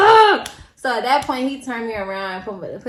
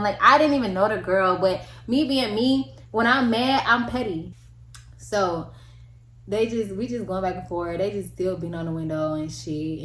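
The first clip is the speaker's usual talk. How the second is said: ordinary talk, nothing marked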